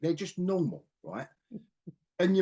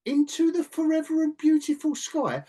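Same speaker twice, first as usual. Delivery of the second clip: high, louder mocking quote; incredulous mock quote; 12 semitones higher, 5 dB louder than usual. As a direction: imitate the line in disbelief, pitched way up. Mocking an unchildlike film line.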